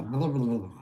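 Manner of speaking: losing her words mid-sentence; blanking on what to say; brain freeze. Real, not acted. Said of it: "I got a brain freeze all of a sudden".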